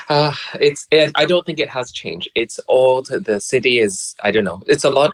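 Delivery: ordinary talk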